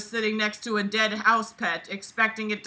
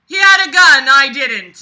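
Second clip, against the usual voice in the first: louder, much higher sarcastic excuse; mock defensive whine; 4 semitones higher, 15 dB louder than usual. Host mockingly voicing the narrator's excuse loudly. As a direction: mimic his whiny excuse.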